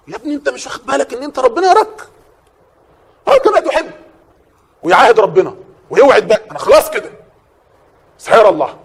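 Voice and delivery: high, loud, urgent rebuke to the congregation; a preacher scolding the young man who forgets God sees him, fiery and loud; fiery preaching. Acted. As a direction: thunder it at the sinner like a pulpit warning.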